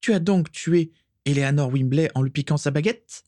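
high, taunting question; sarcastic high-voiced disbelief; mocking. A narrator's reading. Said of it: "railla-t-elle".

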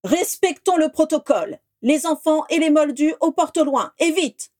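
rally everyone to the exits; commanding headmaster. Acted. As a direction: headmaster directing the evacuation, loud and urgent. Dumbledore gives orders to crowd.

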